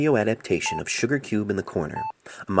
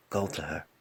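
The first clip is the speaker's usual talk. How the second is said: much softer, gentle farewell; softly; 3 semitones lower, 8 dB quieter than usual. Acted. Doctor bidding the dead patient farewell, soft and gentle. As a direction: say it softly to the departed. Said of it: "he said softly".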